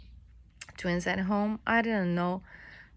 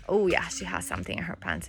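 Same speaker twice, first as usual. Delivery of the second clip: ordinary talk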